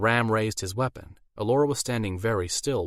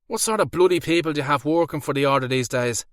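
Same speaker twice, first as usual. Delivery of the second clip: put-on thick Irish accent; 6 semitones higher, 6 dB louder than usual. A narrator's reading. Hurt werewolf cries out to his captors in a thick Irish accent. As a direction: bellow it out in a thick Irish brogue.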